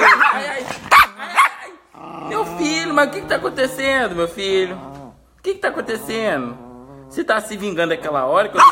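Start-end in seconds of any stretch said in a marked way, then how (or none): 0.0-2.3 high-pitched yelps of ow; squealing in pain. Real, not acted. Pet attacks the speaker. 2.3-6.6 high-pitched, distressed plea to a pet; worked-up, high protest; exasperated pleading. Real, not acted.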